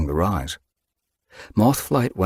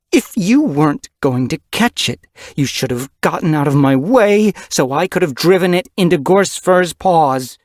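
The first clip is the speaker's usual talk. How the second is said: loud, higher voice, furious reproach; angry scolding; 10 semitones higher, 6 dB louder than usual. Acted. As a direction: tear into her daughter for ruining the hunt. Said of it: "her mew hot with rage".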